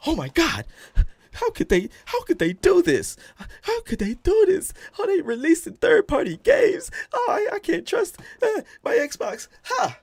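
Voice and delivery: high, wailing fake sob; mock crying. Streamer acting out a heartbroken Xbox fan, high mock wail. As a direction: ham up a fan's despair, wailing high. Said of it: "I'm going through all the motions".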